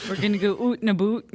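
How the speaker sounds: high, loud mock Canadian; hammed-up Canadian oo vowels; Canadian accent. Shown as a demonstration. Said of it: "go all Canadian".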